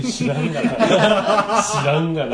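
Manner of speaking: laughing loudly through it; cracking up mid retort. Real, not acted. Laughing at friend's odd movie reference.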